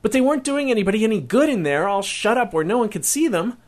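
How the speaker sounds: put-on boy blurting excuses to his mom, fast; in a rush. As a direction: tumble it all out at once. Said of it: "came out all in a rush".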